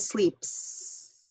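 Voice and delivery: "sleeps" said slowly with a drawn-out hissing S at the end; voiceless S ending. Shown as a demonstration. Teacher to students, modeling the voiceless S ending slowly. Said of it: "a voiceless S".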